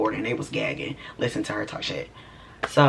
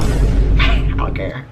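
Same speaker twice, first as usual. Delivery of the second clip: laughing; 4 semitones lower, 8 dB louder, much slower than usual. Real, not acted. Laughing while retelling own remark.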